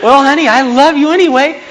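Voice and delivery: high, loud smiling wife voice. Acted. Wife to the man she can't stay mad at, hammed-up smiling sweetness. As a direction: coo it big and high, with a smile. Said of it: "your wife who's smiling at you".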